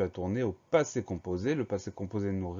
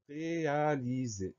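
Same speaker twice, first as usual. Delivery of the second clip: "réaliser" drawn out syllable by syllable, higher pitch; slow dictation; 4 semitones higher, 4 dB quieter, slower than usual. Shown as a demonstration. Teacher announces the next spelling word, slow dictation. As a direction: stretch "réaliser" slowly for spellers to follow.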